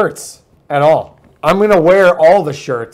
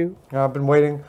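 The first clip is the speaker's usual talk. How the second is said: usual speaking voice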